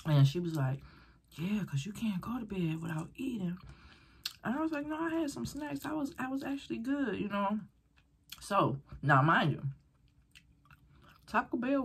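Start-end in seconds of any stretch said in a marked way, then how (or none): 1.3-3.6 soft hushed whisper; whispered reply. Retold or imitated. Friend quoted in hushed voice. 4.9-7.6 light, higher, softer voice; high polite refusal. Retold or imitated. Her own quoted polite refusal.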